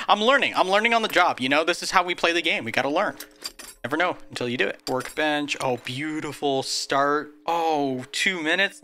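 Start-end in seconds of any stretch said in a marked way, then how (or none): none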